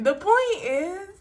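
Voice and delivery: slow, high, crying; pushing words through tears; choked up. Real, not acted. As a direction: voice breaking. Overcome while making her point.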